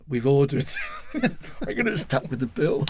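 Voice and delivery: laughing through the words. Real, not acted. Laughing at being left with the lunch bill. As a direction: giggle while saying it.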